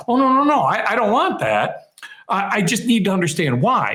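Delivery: high, insistent "oh no no" as a worried customer; protesting client. Retold or imitated. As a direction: imitate a client hurriedly objecting, pitch up. Client protests in retold story.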